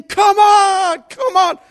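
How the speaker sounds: shouted, high cheering from the roadside; crowd shouting. Retold or imitated. Roadside crowd urging the runner on, shouting. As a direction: yell it like fans urging a runner. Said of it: "they start shouting at him".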